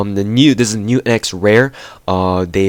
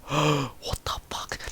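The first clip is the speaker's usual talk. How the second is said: gasp, then a whispered swear; breathless disbelief, barely voiced; shocked whisper; 7 semitones higher, 13 dB quieter, much slower than usual. Real, not acted. Stunned by high ammo stat.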